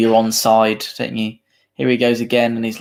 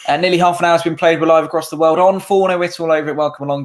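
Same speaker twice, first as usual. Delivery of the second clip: announcer voice; 5 semitones higher, 4 dB louder than usual. Acted. Stream host greeting viewers.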